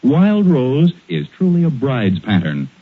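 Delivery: normal talking voice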